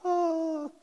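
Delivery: a high, startled "Oh" like a deer in headlights; stunned little gasp. Retold or imitated. Terrified freshman caught off guard, stunned high oh. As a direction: freeze up and squeak it. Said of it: "still there like this".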